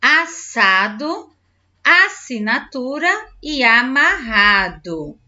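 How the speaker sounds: "Assado, assinatura e amarrado" slowly, split into syllables; "Assado, assinatura e amarrado" in slow, measured beats. Shown as a demonstration. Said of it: "Separe as sílabas das palavras".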